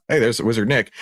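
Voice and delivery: ordinary delivery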